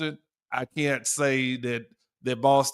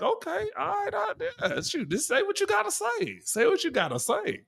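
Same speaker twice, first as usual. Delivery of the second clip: much higher, put-on voice; mock reply; 4 dB quieter than usual. Answering an aggressive Boston woman, high mocking voice. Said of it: "or it's like".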